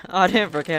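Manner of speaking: teasing shout. Pretend. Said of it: "I'm just being annoyed".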